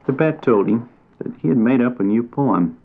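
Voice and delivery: ordinary delivery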